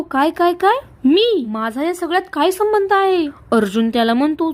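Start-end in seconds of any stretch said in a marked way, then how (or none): 0.0-3.3 a friend caught off guard, protesting 'me?'; startled protest. Acted. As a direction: pitch jumps higher in alarmed disbelief. Friend suddenly asked to help. (चकित होऊन घाबरलेला विरोध)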